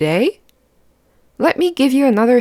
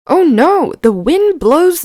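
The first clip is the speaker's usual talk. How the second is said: sudden high "oh no"; alarmed; 7 semitones higher, 4 dB louder than usual. A narrator's reading. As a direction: gasp it out in alarm. Wind blows the list away.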